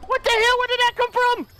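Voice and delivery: high-pitched yell of shock; startled shout. Real, not acted. Gamer hit from nowhere, startled shouting. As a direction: cry out as if ambushed.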